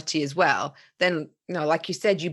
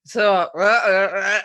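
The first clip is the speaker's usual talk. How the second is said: croaky half-asleep; 6 dB louder, slower than usual. Retold or imitated. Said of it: "my voice is, like, really croaky".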